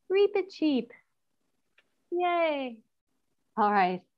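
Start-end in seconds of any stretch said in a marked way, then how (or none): 0.1-2.8 high squeaky mouse-like Reepicheep. Shown as a demonstration. Teacher to class, naming the mouse in a high squeak. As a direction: say his name like a tiny mouse would.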